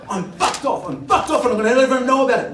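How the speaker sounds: high, loud ranting coked-up guy; hammed-up furious hotel guest; mock rage. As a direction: rant it out, building fast. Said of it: "he's like".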